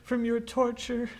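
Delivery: high, slow, reverent question; awed plea. Acted. Thomas asking the ghost for freedom, high and awed. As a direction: ask in awe.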